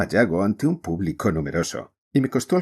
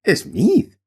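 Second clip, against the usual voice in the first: high, louder excited cry of the name; exclaimed; 12 semitones higher, 5 dB louder than usual. Acted. Mrs Pirouette exclaiming to her dance partner, excited. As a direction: burst out with the name mid-dance. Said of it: "exclamó ésta".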